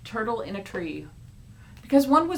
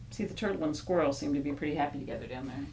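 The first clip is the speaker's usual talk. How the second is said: plain reading voice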